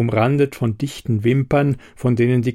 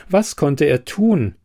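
usual speaking voice

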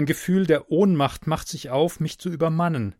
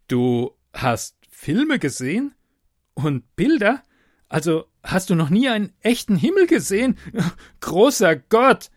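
appalled disbelief; 7 semitones higher, 7 dB louder than usual. Acted. Man learns girl never saw sky, appalled disbelief. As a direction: build from stunned questions to a loud exclamation. Narrator appalled by girl's answer.

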